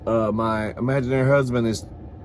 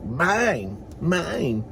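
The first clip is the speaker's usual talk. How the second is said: put-on bratty playground kid; 4 semitones higher than usual. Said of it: "You were all like".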